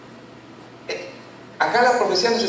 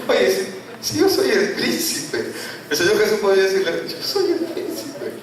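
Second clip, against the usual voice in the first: amused retort. Retold or imitated. Jokes about Jesus's reply to Satan.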